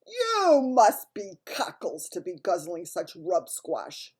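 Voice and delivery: loud, slow, menacing Bloodbottler bellow; booming giant. A narrator's reading. Bloodbottler sneers at the BFG's food, booming loudly. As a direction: boom it out like a huge brute giant.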